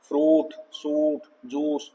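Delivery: फ्रूट, सूट, जूस each with a long oo; careful list फ्रूट, सूट, जूस with long vowels; long oo sound. Shown as a demonstration. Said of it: "बड़ा ऊ".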